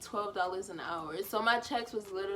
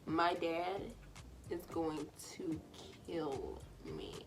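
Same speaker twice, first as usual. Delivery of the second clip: quieter, sinking dread; 3 semitones lower, 8 dB quieter, slower than usual. Real, not acted. Realizing her dad will find out, hushed dread. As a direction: let the fear drop into a hush. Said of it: "Then it hits me".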